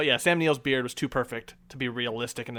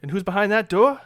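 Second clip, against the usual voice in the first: minister voice; 4 semitones higher than usual. Retold or imitated. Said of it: "and just says".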